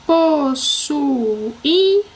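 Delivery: possuía very slow, po, ssu, í, a; stretched syllables. Shown as a demonstration. Teacher introducing a word to divide, possuía stretched out. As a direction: draw out every syllable of possuía. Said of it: "Eu vou separar".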